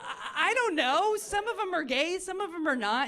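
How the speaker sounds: high, flustered, half-laughing deflection; caught-off-guard comic dodge. Retold or imitated. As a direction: stall nervously while laughing it off. Flustered answer to mom.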